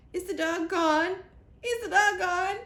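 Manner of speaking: high, loud panicky squirrel squeal; panicked squirrel. A narrator's reading. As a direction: repeat it in rising panic, twitching. Twitching squirrel panics repeating.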